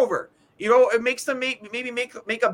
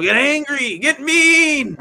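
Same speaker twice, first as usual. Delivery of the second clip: loud, raised-pitch urging, drawn out; exasperated shout; 5 semitones higher, 6 dB louder, much slower than usual. Retold or imitated. Fan urging the team to toughen up, exasperated shout.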